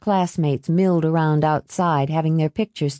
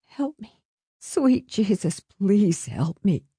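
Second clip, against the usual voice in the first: gasping; 3 semitones higher, slower than usual. A narrator's reading. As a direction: gasp a slow plea for help. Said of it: "she gasped".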